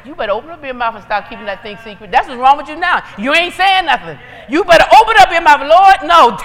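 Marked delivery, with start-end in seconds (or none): 4.5-6.4 loud, forceful preaching; urgent exhortation. Real, not acted. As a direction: push it out loudly and urgently. Preacher urges speaking up, models prayer.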